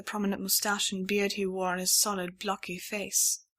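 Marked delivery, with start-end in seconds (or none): none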